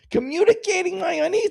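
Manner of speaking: mocking echo. Mock echo of husband's phrase.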